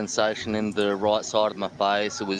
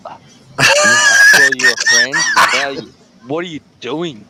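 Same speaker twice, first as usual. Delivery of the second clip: louder, hammed-up jeering mate; mock crowd heckle; 4 semitones higher, 12 dB louder than usual. Imitating a friend heckling him from the crowd, mock loud. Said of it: "a mate in the crowd that's looking at me, shaking his head, going".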